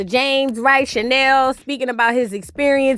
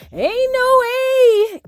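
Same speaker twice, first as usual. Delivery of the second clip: slow, much higher, stretched-out no way; drawn-out refusal; 10 semitones higher, much slower than usual. Shown as a demonstration. Refusing to forgive the attacker, slow, high and stretched. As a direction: stretch every word in disbelief.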